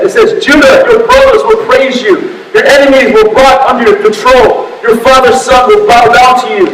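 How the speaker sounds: high, ringing preacher's reading; declaimed scripture. Real, not acted. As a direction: proclaim the verses with force.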